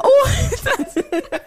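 high laughing squeal; giggling disbelief. Real, not acted.

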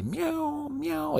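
high, soft meows; cat meows. Retold or imitated. Teacher meows softly as the Osaka cats. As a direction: meow like a street cat.

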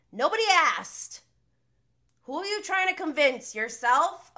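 loud, high, sassy put-down; loud scornful jab at Schwartz; scoffing retort. Real, not acted. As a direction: fire back at him, loud and mocking.

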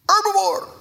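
put-on high child's voice; child's answer. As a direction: pipe up like an eager kid.

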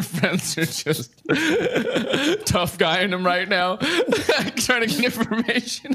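words squeezed out through high giggles; cracking up mid-sentence; game master laughing at the players' tough act. Real, not acted. GM laughing while narrating.

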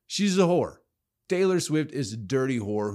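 heated outburst; angry outburst. Real, not acted. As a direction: bite it off, pitch up. Rant peak.

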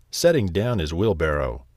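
ordinary delivery

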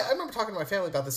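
normal talking voice

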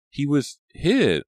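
high, loud, drawn-out distress; trembling voice. A narrator's reading. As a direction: say it slowly, shaken and high. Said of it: "her voice trembling".

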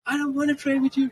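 put-on high, whiny child's refusal; whiny kid. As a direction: whine it like a fed-up little boy.

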